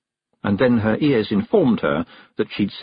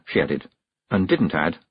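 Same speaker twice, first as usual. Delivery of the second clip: ordinary delivery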